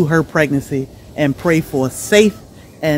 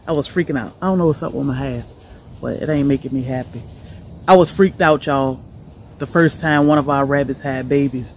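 usual speaking voice

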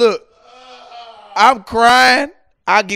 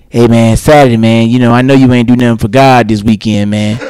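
louder, lower imitation of his father; daddy's voice; 7 semitones lower, 10 dB louder than usual. His father calls urging him to church. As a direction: lay on the preacher-dad push.

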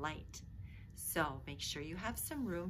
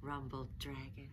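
put-on expressive read-aloud for children; lively picture-book reading voice; storybook narration; 3 semitones higher, 9 dB louder, slower than usual. A narrator's reading. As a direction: tell it like a bedtime story.

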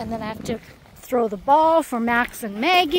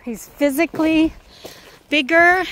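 usual speaking voice

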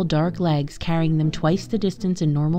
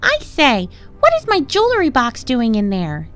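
high-pitched, indignant townswoman; Missus Limpet; 7 semitones higher, 3 dB louder than usual. A narrator's reading. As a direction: demand an answer in a high, affronted voice. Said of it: "demanded Missus Limpet".

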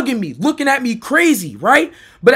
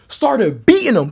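usual speaking voice

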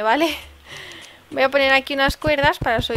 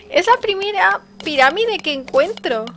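higher, thrilled voice; excited; 6 semitones higher than usual. Real, not acted. Gamer telling viewers about her first pyramid, excited. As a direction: still buzzing with the discovery.

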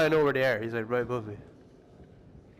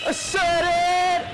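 drawn-out yell; 6 semitones higher, 3 dB louder, slower than usual. Real, not acted. Taunting while fighting.

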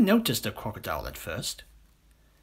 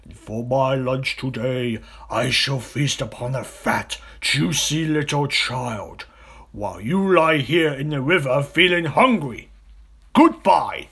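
slow, louder relishing gloat; gloating crocodile; 3 semitones higher, 6 dB louder than usual. Acted. Crocodile gloats about eating a child. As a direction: savour every word of the feast.

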